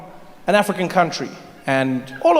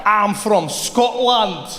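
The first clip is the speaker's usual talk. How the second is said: put-on slow, higher Scottish accent; 4 semitones higher, much slower than usual. Answering the shopkeeper, slow Scottish accent. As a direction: claim to be a local, in broad Scottish. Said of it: "speak to people in their accent".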